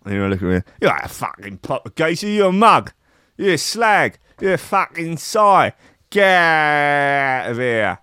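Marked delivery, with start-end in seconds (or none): 0.8-5.7 high, punchy mock-Cockney tirade; hammed-up Cockney hard-man rant; Danny Dyer. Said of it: "Danny Dyer, Cockney Jesus". 6.1-8.0 drawn-out Cockney dismissal; hammed-up, slow Cockney send-off; Danny Dyer. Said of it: "Danny Dyer, Cockney Jesus".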